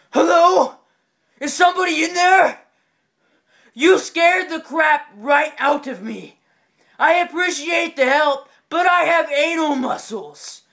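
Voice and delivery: loud, high hollering from the next stall; startled shout. Acted. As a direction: call out rattled and indignant, drawing the words out. Retold man shouting from toilet stall.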